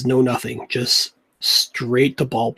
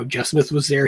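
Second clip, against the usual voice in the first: ordinary talk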